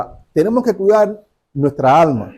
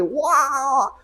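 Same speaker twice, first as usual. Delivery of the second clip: long, rising mock roar; angry roar; 8 semitones higher, 6 dB quieter than usual. Preacher showing the woman's anger like Shrek, drawn-out roar. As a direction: stretch out an imitated roar of anger. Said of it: "De enojo, de".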